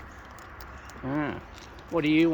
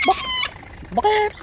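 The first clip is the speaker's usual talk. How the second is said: high call; 4 dB louder than usual. Real, not acted. Calling the bird.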